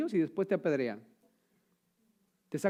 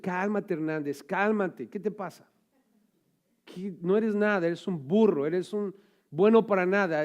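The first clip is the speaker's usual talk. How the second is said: scolding himself; 4 semitones higher, 5 dB louder than usual. Pretend.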